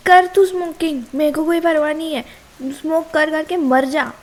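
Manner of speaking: furious scolding. Acted. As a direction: tear into him for faking the stickers. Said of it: "Yes, I am mad".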